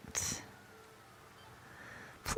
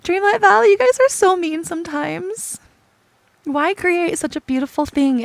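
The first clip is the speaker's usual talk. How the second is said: playful loud scold; 5 semitones higher, 8 dB louder than usual. Real, not acted.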